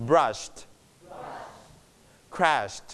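"brushed", "crashed" ending in a light t; one syllable each, "brushed", "crashed", soft t; softened final t. Shown as a demonstration. Teacher models for the class, light t on "brushed", "crashed". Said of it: "ลดเสียงเธอมาเบาๆ".

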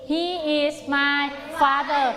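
very slow, word-by-word drill answer; slow choral answer. Shown as a demonstration. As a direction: chant it slowly with the class. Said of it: "ตอบพร้อมกันนะ".

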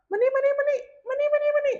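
high, rapid-fire repeated calling; mock-impatient beckoning in a high voice; pestering summons. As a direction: rattle it off high and insistent. Mimics an impolite, pestering summons.

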